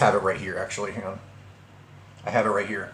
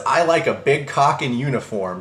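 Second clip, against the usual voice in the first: plain reading voice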